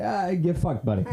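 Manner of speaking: high-pitched mock brush-off; dismissive retort. Comedian to complaining driver, dismissive retort. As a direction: toss the insult off at the other driver.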